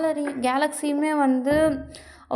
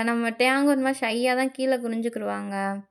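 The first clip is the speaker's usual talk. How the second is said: ordinary delivery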